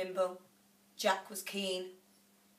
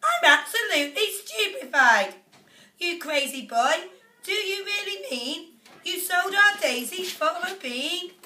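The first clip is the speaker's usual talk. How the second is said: higher and louder, aghast mother; shocked shriek; 10 semitones higher, 7 dB louder than usual. A narrator's reading. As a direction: she can't believe her ears. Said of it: "leapt up high in the air and cried".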